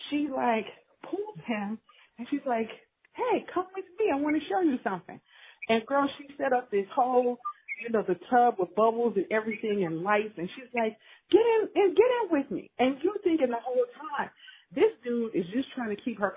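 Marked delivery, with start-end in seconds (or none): 3.1-5.2 high, fast, giddy invitation; playful ditzy girlfriend. Retold or imitated. Ditzy girlfriend lures husband. 11.3-12.7 much higher, louder, eager invite; giddy girlfriend. Retold or imitated. Girlfriend invites husband into tub.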